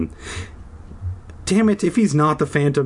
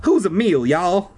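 higher, folksy character voice; high-pitched confused questioning; Dot's character voice; 7 semitones higher than usual. Acted. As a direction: put on a high "y'all" character. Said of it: "See, I gave her a character, too".

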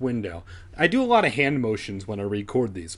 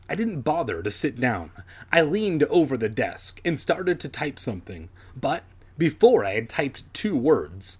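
normal talking voice

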